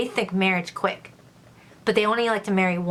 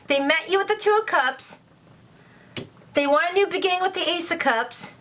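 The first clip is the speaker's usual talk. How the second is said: thrilled; 5 semitones higher than usual. Real, not acted. Thrilled by cards in reading.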